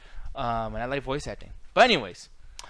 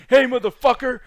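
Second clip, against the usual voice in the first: much higher and louder, a mock holler; mock angry yell; 9 semitones higher, 12 dB louder than usual. Alan banging on his room door, mock shouting. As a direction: yell it through the hotel door. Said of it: "He's gonna be knocking there like".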